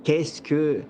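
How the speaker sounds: calm, measured storytelling voice; game master narration. A narrator's reading. Game master sets the scene.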